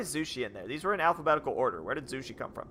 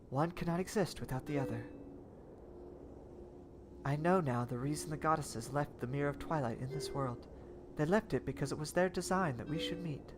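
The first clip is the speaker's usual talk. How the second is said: soft, even robot voice; 7 dB quieter than usual. Acted. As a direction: voice Zelda as a calm robot. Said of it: "doing, like, a robot voice for her".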